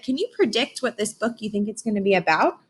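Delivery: ordinary delivery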